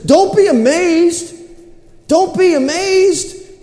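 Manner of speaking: high, drawn-out exhortation; slow, high-pitched proclamation; acted preaching. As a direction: stretch it out like a preacher addressing a crowd. Acts Peter preaching to the crowd.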